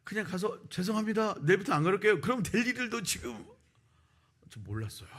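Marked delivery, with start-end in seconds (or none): 0.0-3.4 high-pitched mock dismay; exasperated telling-off, pitched well up; shocked scolding. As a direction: act out an alarmed senior colleague scolding. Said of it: "그 대리님이 너무 놀라는 거야".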